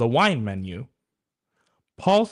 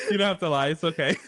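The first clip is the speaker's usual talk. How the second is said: higher pitched, through laughter; laughing reassurance; 5 semitones higher, much faster than usual. Real, not acted. Joke teller to polite co-host, laughing. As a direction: let the giggles run through the words.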